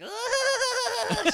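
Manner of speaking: very high, loud mock squeal through laughter; squeaky squirrel squeal. Host imitating the matron squirrel for a co-host, squeaky squeal. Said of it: "a lower, like, squeaker voice".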